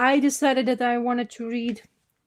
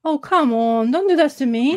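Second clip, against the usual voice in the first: louder, higher exasperated plea; pleading with a machine; 4 semitones higher, 5 dB louder than usual. Real, not acted. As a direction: beg the device not to fail.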